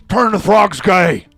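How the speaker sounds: slow, loud, high ranting; Alex Jones line. Pretend. Host quoting the classic rant, slow loud mock Alex Jones. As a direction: drag out each word like a rabid talk host. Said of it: "That's the classic line".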